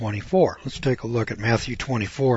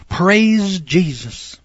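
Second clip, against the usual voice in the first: higher, slow drawn-out mock praise; mock preacher; 6 semitones higher, 4 dB louder, much slower than usual. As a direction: lampoon a showy preacher. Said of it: "people saying".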